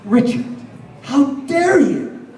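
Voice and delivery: high, indignant imitation of angry friends; hammed-up outrage, pitched high; mock outraged accusation. Friends confronting him about the other Richard Hatch, mock outrage. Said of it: "they were pissed off at me".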